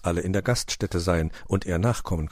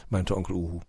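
usual speaking voice

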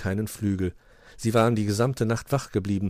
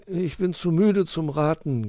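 slow, sleepy, higher-pitched voice; yawning; 8 semitones higher than usual. A narrator's reading. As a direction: drag the words through a yawn. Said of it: "gähnte Willi".

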